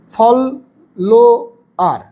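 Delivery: "ফলোয়ার" slowly broken into three syllables. Shown as a demonstration. Teacher showing syllable division, "ফলোয়ার" syllable by syllable. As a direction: break "ফলোয়ার" up as fol, lo, ar. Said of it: "তারপরে সিলেবেলিক division".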